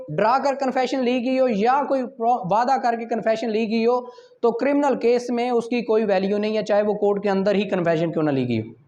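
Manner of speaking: ordinary delivery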